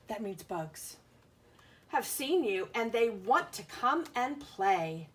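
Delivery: ordinary delivery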